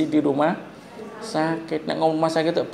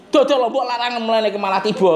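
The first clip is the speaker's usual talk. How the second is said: market bluntness; 9 semitones higher than usual. Retold or imitated. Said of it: "Tapi ngomongnya piye?".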